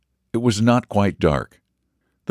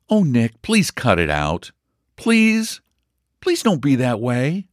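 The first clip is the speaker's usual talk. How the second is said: pleading; 8 semitones higher, slower than usual. A narrator's reading. Marjorie pleading with sulky Nick.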